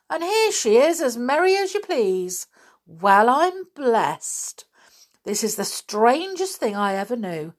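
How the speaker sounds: high, emphatic wonder; amazed. A narrator's reading. Inspector marvels at the missing girl turning up, amazed. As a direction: exclaim with swooping astonishment.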